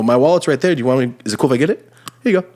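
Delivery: high, fast eager politeness; overly polite, quick and helpful; customer service voice. Shown as a demonstration. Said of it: "the smile and the customer service voice".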